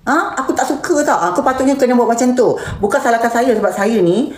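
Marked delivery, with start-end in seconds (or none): 0.1-1.2 high, fast scolding of the asker; mock angry rant. Streamer acts out telling off a nosy asker, fast and high. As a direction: snap at them, indignant and fast. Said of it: "kena DM orang yang tanya tu".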